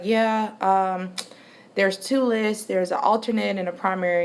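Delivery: normal talking voice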